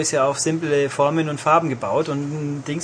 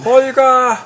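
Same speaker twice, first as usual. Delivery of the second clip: high, loud call across the sea; 10 semitones higher, 8 dB louder than usual. Pretend. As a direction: yell the name like the sea calling. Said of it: "Und wie ruft das Meer?".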